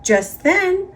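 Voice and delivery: ordinary delivery, nothing marked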